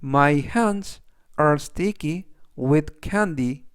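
"It has a round shape" read very slowly, word by word; deliberate classroom read; slow, clear reading. Shown as a demonstration. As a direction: spell it out slowly for learners. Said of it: "그래서 전체적 의미는".

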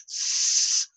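voiceless S hiss. Shown as a demonstration. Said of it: "they can't make that little".

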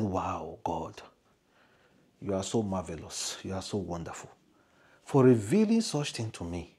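soft, hushed, in wonder; awed whisper. Acted. The speaker thanking God in a hushed whisper.

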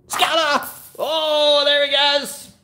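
high, loud excited cry; excited shout. Real, not acted. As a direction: cheer as the dog runs off. Calling out the game.

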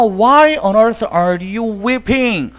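every word of "He is the very man" spaced out very slowly; slow model reading. Shown as a demonstration. English teacher reading the example sentence very slowly. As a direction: read it out word by word for the class. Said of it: "example sentence".

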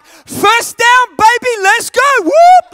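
loud, high whooping cheer; pumped-up fan yell. Retold or imitated. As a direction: yell it like a newly converted superfan. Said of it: "I was so pumped and so excited".

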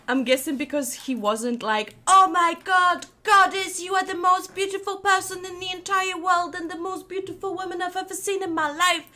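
normal talking voice

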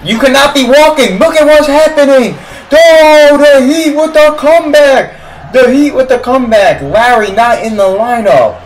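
very high, strained fan yelling; loud, high-pitched outburst; worked-up shouting. Real, not acted. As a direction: holler in disbelief at a comeback. Yelling as the Heat come back.

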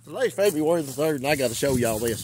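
loud emphatic. Real, not acted. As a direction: call it out to the viewers. Date repeated loudly for emphasis.

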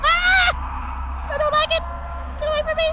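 high panicked shrieking; scared scream. Real, not acted. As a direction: yell in terror as it nears. Fleeing the monster in game.

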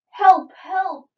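high, loud cry for help; alarmed cry. A narrator's reading. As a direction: call out in a storybook panic. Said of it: "cried Carrot".

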